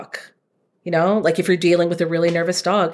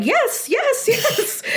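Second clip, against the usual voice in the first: squealing high with delight, laughing; gleeful praise while tossing treats; over-the-moon cheering for the dog; really happy; much slower than usual. Retold or imitated. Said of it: "really happy me going".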